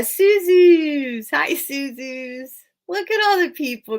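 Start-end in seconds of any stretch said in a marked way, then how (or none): 0.0-2.5 slow greeting. Real, not acted. Drawn-out greeting to viewer.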